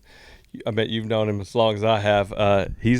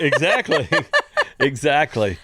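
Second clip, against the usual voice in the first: laughing; 4 semitones higher than usual. Real, not acted. Laughing at the joke.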